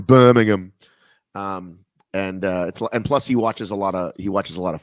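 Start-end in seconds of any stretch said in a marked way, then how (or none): 0.0-0.7 put-on Birmingham accent, louder; mock Birmingham accent. Host naming where Peaky Blinders is from, mock Birmingham accent. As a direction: say Birmingham as a mock local would. Playful British imitation of place name.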